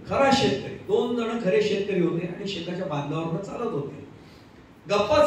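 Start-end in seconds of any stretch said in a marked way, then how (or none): none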